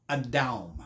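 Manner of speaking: low-pitched Adam, stressed on a drawn "DOWM"; original pronunciation repeated. Shown as a demonstration. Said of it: "the actual way to pronounce Adam's name".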